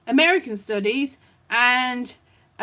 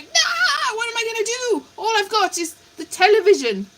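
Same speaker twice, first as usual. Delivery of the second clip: mock despair; 10 semitones higher, 4 dB louder than usual. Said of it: "I was like".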